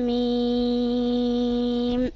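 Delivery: slow, measured tajweed chant; Quran recitation. Acted. Student reciting Baqarah to her teacher, chanted. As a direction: recite each verse as a trained reciter.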